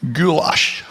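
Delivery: imitating Sean Connery's Scottish "goulash". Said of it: "heard Sean Connery utter the word".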